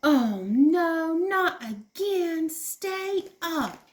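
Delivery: put-on high, loud frustrated little kid; upset child. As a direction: whine as the tower falls. Child's blocks keep falling.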